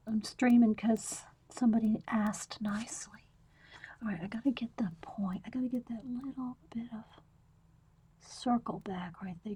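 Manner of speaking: soft whisper, close to the mic. Real, not acted. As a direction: whisper as you draw. Whispers while drawing.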